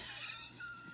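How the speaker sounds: breathless high-pitched squeal of laughter. Real, not acted. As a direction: shriek with laughter, airy and high. Cracking up at the scene.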